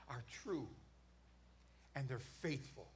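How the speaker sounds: drops to a low hush on true and faithful; softening. Acted. Preacher hushing God's promise to the church. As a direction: let the words fall quiet and solemn.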